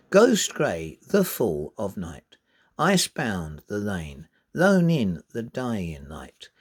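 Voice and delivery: slow poem reading with strong beats leaned on; emphasised reading. Shown as a demonstration. Teacher reading the whole poem with stresses lightly emphasised. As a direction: read the poem marking each stress. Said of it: "I've emphasised it a little bit".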